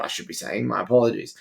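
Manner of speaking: ordinary talk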